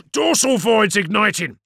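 loud, high urgent callout; battle report. Acted. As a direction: bark the report across the bridge. Bridge crew report during battle.

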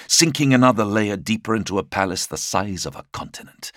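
ordinary delivery

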